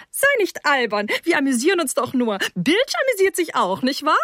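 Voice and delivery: high, tipsy mother's chatter; high-pitched, giddy motherly protest; giggly mother. Acted. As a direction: brush it off in a high, silly voice. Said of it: "sagte seine Mutter kichernd".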